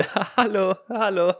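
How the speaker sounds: laughing greeting, high and loud. Real, not acted.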